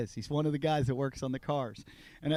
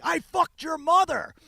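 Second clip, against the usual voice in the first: high, loud, drawn-out mock yell; imitated screaming; 12 semitones higher, 7 dB louder, slower than usual. Enraged driver insulting the man laughing at him, screamed. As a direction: hurl the insult in a slow, strained scream. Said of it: "he's screaming at me".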